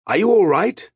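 high, loud checking shout; urgent call. A narrator's reading. Martin to blinded Arutha in the storm, shouted question.

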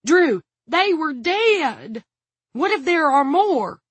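high, loud, drawn-out wail; panicked protest. A narrator's reading. Frightened guard protests to gunman, panicking. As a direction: plead in high-pitched alarm.

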